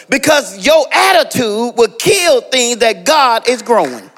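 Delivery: rising, shouted sermon climax; stretched, shouting pulpit delivery; preaching at full force. Acted. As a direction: drive it home like a preacher.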